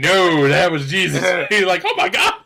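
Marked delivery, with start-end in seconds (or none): 0.0-1.2 loud, slow, insistent correction; mock-stern friends correcting him; insistent retort. Retold argument over thrown figurine. 1.8-2.3 much higher, loud dismayed cry; a squeaky horrified gasp of words; realising he threw Jesus; shocked oh my God. Retold or imitated. Retold reaction to Jesus toy.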